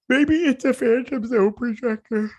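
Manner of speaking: very high, loud squeak; high squeaky voice. Real, not acted. Viewer guessing what the device is, high squeaky voice. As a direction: squeeze it out way up high. Odd squeaky take, repeated after pardon me.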